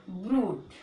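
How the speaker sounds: "ब्रूट" with long oo, ending on t; model pronunciation. Shown as a demonstration. Teacher models the word for learners, model pronunciation "ब्रूट". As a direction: say "ब्रूट" as one syllable. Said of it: "यू करके".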